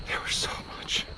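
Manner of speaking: breathy exhausted whisper. Real, not acted. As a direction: whisper it, spent.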